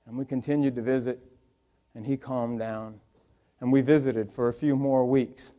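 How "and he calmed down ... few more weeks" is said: ordinary talk, nothing marked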